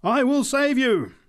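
very high, slow, loud cry; drawn-out evangelical shout; top of her voice. A narrator's reading. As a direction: holler salvation across the crowd. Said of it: "at the top of her voice".

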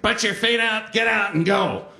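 commanding jumpmaster in a put-on voice; leprechaun instructor. Instructor to cadet at the plane door, commanding. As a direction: order the cadet out, hammed-up. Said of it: "a leprechaun turns to me and just goes".

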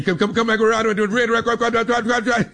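fast, stammering Southern mumble; lightly voiced boyfriend stumbling over words; impression of the boyfriend. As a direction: imitate a rushed, stuttering country talker. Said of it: "That's how he sounds".

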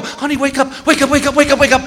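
high, rapidly repeated plea; frantic urging. Retold or imitated. Husband tries to wake sleeping wife before crash, frantic. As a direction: urge it over and over in mock panic.

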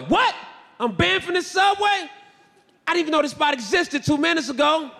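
high-pitched mock disbelief; incredulous. Customer banned from the Subway, incredulous. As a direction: protest it as absurd news.

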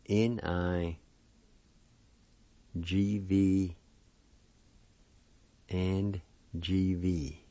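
letters read slowly in small groups; spelled out. Shown as a demonstration. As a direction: spell it out with long pauses. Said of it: "it's spelled this phonetically".

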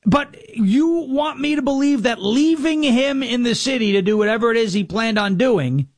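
ordinary delivery

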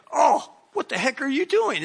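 high, sharp yelp of pain and surprise; startled outcry. Retold or imitated. Man kicked in the shin yelps at the kicker.

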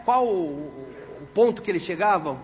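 ordinary talk

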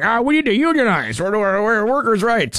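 a mock kiosk-era protester pleading for workers' rights; mock union agitator. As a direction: lampoon a worker crying for a union. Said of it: "nobody's gonna run around going".

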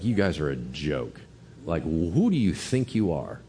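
understated mock self-righteous contempt; smug. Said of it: "He has the most smug, self-righteous look".

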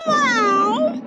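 high meow bursting out; a sudden playful high cat meow. A narrator's reading. Narrator voicing the cheetah bursting in, high meow.

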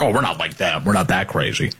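a hint of a smug establishment liberal; prissy establishment lefty. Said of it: "establishment lefties who are like".

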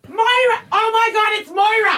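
high yelled excitement; calling out. Retold or imitated. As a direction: shout her name like a fan. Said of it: "you just start going".

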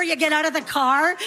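ordinary talk, nothing marked

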